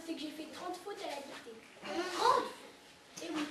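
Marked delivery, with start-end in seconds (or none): 2.2-2.5 sharp disbelieving cry, much higher and louder; shocked. Acted. Father hearing his son's thirty dictation mistakes, shocked cry. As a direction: blurt it out in outraged disbelief.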